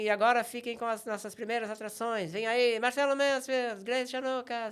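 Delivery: high, loud, slowed showman announcement; cabaret host voice. Retold or imitated. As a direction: draw out the names like an emcee. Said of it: "eu anunciava as atrações".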